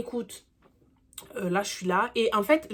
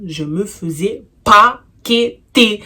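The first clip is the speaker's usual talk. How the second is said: slow emphatic; 2 semitones higher, 10 dB louder, much slower than usual. Retold or imitated. Dramatic retelling of the beating.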